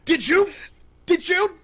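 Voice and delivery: high, shouted panicked accusation; horrified. Acted. Man accusing intruders over the body, horrified. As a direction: recoil and yell it in horror. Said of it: "He jumps up and jumps back".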